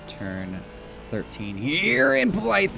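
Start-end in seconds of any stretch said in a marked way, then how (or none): 1.6-2.7 high loud rising crash call; excited race call. Real, not acted. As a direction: build to the contact.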